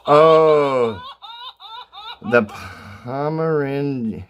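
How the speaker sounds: ordinary talk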